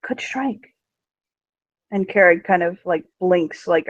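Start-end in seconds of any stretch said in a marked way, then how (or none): 0.0-0.7 conspiratorial whisper. Acted. Demonia whispers the plot to Carrig. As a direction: drop to a whisper on the last words. Demonia plotting against the king.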